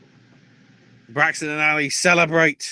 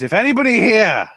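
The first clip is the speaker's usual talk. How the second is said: priest; 4 semitones higher, 4 dB louder than usual. Retold or imitated. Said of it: "the priest goes".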